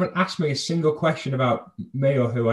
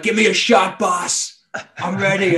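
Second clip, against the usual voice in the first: mock shout, pitched higher; imitated eager shouting; 5 semitones higher, 3 dB louder than usual. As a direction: call out like a bouncer begging for a turn. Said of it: "like, shouting, like".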